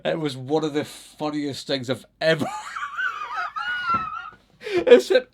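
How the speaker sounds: words breaking into loud laughter; laughing. Real, not acted. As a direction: try to talk but dissolve into laughter. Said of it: "for the tears in my eyes".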